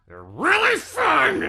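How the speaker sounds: slow, hammed-up high shout; mock angry shout. Host joking that angry characters are fun to play, mock angry shout. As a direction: drag it out in a playful, loud yell.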